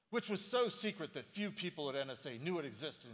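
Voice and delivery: so stretched and lifted high; stress on so. Shown as a demonstration. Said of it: "dramatic reading".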